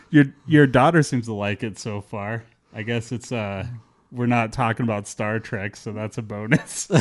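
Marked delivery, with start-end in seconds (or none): none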